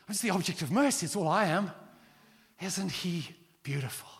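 ordinary delivery

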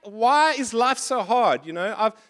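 a hint of a whining Jeremiah; complaining prophet. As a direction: plead like a frustrated prophet, mildly. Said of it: "he's like".

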